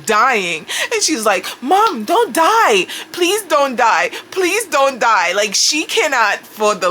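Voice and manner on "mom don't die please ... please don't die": higher, slow, mock-tearful teen begging; imitating pleading daughter. Teen daughter begging her dying mom, mock pleading. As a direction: plead it slowly like a scared kid.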